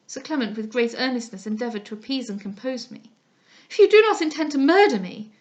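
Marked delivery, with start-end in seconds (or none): 3.7-5.3 much louder, higher desperate cry; terrified cry. Acted. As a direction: cry out in real terror. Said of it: "cried I".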